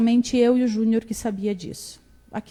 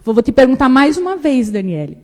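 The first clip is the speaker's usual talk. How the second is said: louder, stern final question; serious third ask; 3 semitones higher, 8 dB louder than usual. Retold or imitated. Grandmother asking a third time.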